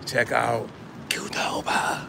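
whispered restaurant name; hushed stage whisper as he walks in. Real, not acted. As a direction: breathe the name as a secret.

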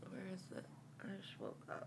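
sleepy whisper; barely-voiced just-woke-up voice. Real, not acted. Partner just woke up, whispering.

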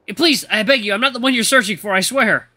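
high, loud begging; panicked pleading. Acted. Eli begs the ghost to spare him, frantically pleading. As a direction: plead for your life.

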